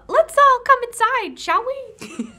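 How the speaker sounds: high, loud, brisk invitation; forced cheery invitation. Acted. Shopkeeper herding the visitors into the shop, high and over-bright.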